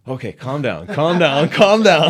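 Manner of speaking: put-on rising panic at the little girl, cracking into a laugh; losing it, building. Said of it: "and I'm just like".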